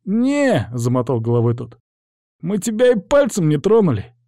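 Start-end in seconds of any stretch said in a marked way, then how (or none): 0.1-0.7 put-on high-pitched boyish denial; defensive, high young hooligan; denying lad. Boy denies, shaking his head. 2.4-4.1 put-on high-pitched boyish denial; defensive, high young hooligan; denying lad. Boy protests innocence.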